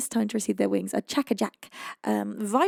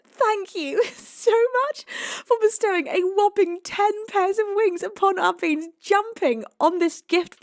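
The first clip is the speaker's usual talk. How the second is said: excited; 8 semitones higher, 5 dB louder than usual. Real, not acted. Excited thanks to big donor.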